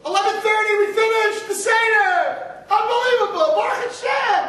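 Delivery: high, loud mock-triumphant cheer. Mocking families who rush the Seder, triumphant shout. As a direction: crow it like they won a race.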